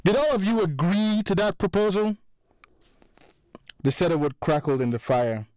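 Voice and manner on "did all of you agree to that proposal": angry demand. A narrator's reading. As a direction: demand an answer from the room. Mike confronting the room.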